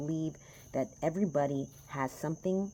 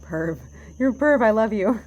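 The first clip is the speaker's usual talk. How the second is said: loud, high giggly teasing; playful teasing; 8 semitones higher, 14 dB louder than usual. Real, not acted. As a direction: tease him with a laugh, much louder. Teasing partner off camera.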